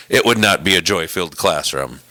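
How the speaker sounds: ordinary talk, nothing marked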